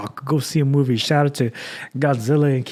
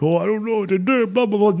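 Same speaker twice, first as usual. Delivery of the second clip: a lightly put-on whiny, helpless voice, pitched higher; mocking his own self-pity; mock whining; 7 semitones higher than usual. Mocking a sad podcaster crying on air, whiny and higher. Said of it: "being like".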